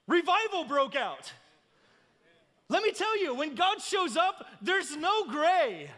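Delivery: impassioned preaching. Real, not acted. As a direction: preach it with mounting urgency. Preaches at full force.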